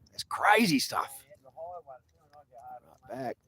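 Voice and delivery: breathy mock-awed whisper. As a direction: hush it like something unbelievable. Mock awe at backwards skating.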